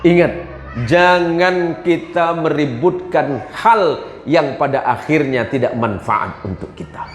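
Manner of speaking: usual speaking voice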